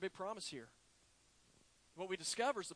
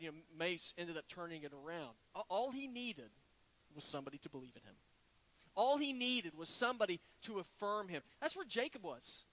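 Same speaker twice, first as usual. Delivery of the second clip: usual speaking voice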